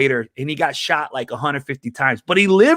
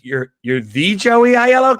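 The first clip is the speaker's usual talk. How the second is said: higher, louder astonished question, drawn out; amazed; 6 semitones higher, 5 dB louder than usual. Retold or imitated. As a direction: draw out THE in loud disbelief. Museum lady amazed at his name.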